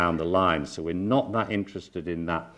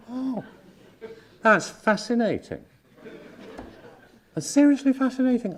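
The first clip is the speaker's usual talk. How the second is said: marvelling. Acted. Therapist reacting to the group's denial, high-pitched fascination. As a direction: marvel in a raised, put-on pitch.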